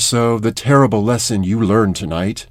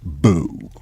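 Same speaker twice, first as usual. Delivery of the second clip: low, drawn-out Halloween "Boo." said well below his usual pitch; mock spooky boo; 8 semitones lower, 5 dB quieter than usual. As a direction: lower your voice for a playful ghost's "Boo.".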